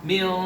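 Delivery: "Mil" held as a long, dark nasal hum; thick ikhfa. Shown as a demonstration. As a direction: hold "Mil" half-hidden, tongue not yet touching for Q. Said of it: "baru masuk". ("Mil" dengung tebal samar, belum masuk qaf)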